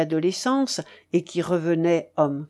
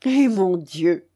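slow, mildly exasperated exclamation; drawn-out, understated outcry; exclaiming; 4 dB louder, slower than usual. A narrator's reading. Old man watching family smother the son, mild exclamation. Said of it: "s'écriait le père Dany".

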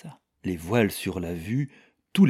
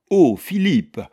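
high, loud cry of reproach; reproachful exclamation; 9 semitones higher, 9 dB louder, slower than usual. A narrator's reading. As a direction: burst out in protest at a husband. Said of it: "arraché sur le ton du reproche".

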